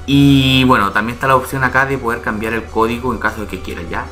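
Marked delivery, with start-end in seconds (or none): none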